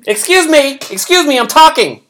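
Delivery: high, loud indignant interruption; indignant judge. Retold or imitated. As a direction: cut them off, offended.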